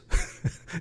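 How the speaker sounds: short breathy chuckle; laugh. Real, not acted. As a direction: chuckle at your own joke. Amused by own joke.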